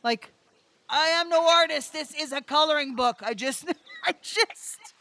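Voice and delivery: high, panicked, half-laughing wail; panicky disbelief. Retold or imitated. Panic attack over the artist across the street, high fretful wail. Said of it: "I'm like".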